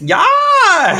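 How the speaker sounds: very high yelled war cry; long, high battle yell. Acted. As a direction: whoop it like warriors charging. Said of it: "That's their war cry".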